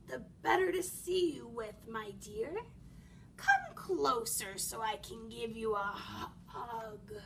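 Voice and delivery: low-pitched, sly coaxing; wolf posing as granny. Acted. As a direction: lure her in, catching on HUG.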